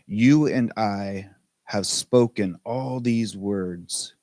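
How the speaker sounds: slow, measured verse with long pauses; unhurried recital of a poem; poem reading. Acted. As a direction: read it line by line, letting each phrase sit. Reads a Rumi poem aloud.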